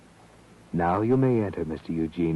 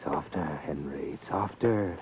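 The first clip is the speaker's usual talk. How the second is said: soft, urgent hush; hushed whisper; 4 semitones lower, 6 dB quieter, slower than usual. A narrator's reading. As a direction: whisper it like a warning on the stairs. Narrator hushes Henry on the staircase.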